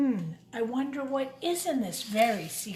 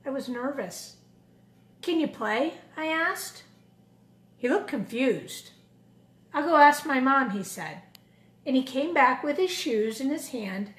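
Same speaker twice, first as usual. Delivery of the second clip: put-on lively storybook narration for kids; a children's book read aloud; 5 dB louder than usual. A narrator's reading. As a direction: read it like story time in class. Reads picture book to children.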